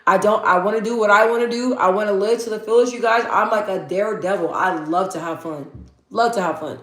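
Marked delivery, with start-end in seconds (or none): none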